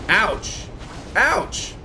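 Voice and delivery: sharp yelp. Real, not acted. Reacting to game hits.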